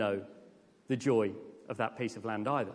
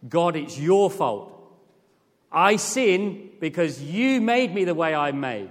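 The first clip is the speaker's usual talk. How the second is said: whiny blame; 3 semitones higher, 6 dB louder, slower than usual. Retold or imitated. Mock complaint to God.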